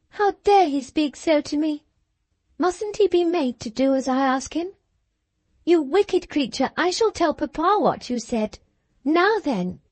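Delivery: put-on high, outraged childish threat; indignant girl. A narrator's reading. Cathy threatens to tell papa, high indignant girl.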